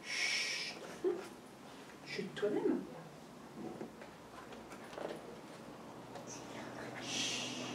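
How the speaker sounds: soft whispered hush; shushing. Acted. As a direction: hush the listener gently. Hushing before the story. (chuchotement doux)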